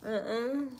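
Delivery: ordinary delivery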